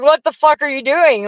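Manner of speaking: outraged retold yell at the fleeing driver; retold angry yell. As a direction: act out yelling at the driver who fled.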